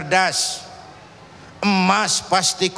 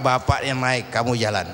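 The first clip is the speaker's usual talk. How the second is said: calm father; 8 semitones lower than usual. Acted. Father answers son in parable.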